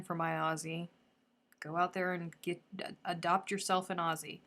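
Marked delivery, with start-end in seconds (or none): none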